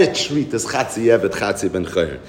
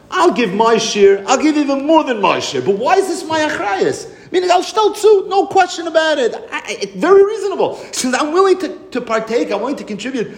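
indignant landlord; 11 semitones higher than usual. Retold or imitated. Landlord protests to the Rebbe, high indignant shouting. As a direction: play the landlord crying out how unfair it is.

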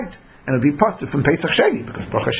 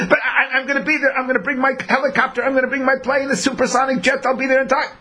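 high, excited, half-laughing boast; eager boasting; 8 semitones higher, faster than usual. Retold or imitated. Far-off traveler promising to arrive by helicopter, high laughing eagerness. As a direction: brag you'll make it by jet.

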